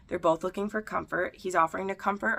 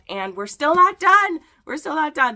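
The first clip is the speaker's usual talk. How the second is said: loud, high, emphatic exclamation; real exasperated outburst, voice jumping up; exclaiming in disbelief; 10 semitones higher, 10 dB louder than usual. Real, not acted. Exasperated at book running past show.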